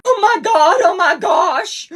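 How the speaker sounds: high, slow crying shout; hysterical crying. Acted. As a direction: sob and gasp in shock. Acted Big Brother grief scene.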